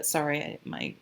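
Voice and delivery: usual speaking voice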